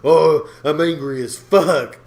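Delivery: loud, slow, flat I'm angry; deadpan anger. Pretend. Walking in on his girlfriend, calmly announced anger. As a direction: announce anger without feeling it. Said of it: "creepy as fuck if I walked in".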